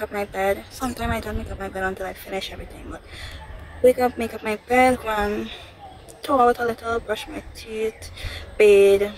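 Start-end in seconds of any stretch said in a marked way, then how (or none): none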